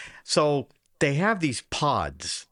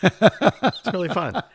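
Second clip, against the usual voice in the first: laughing; 7 semitones higher, 6 dB louder, much slower than usual. Real, not acted. Laughing about Jaeger shots.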